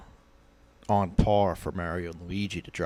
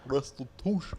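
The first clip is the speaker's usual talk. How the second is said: put-on Shannon Sharpe accent; 6 semitones higher, 6 dB quieter than usual. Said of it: "to do the accent".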